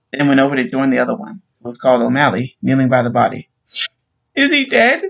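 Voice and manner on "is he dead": much higher anxious cry. Acted. Wife asking policeman about husband, high anguished cry. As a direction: cry out in fake alarm. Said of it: "she cried".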